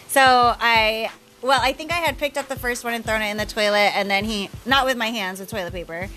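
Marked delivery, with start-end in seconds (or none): none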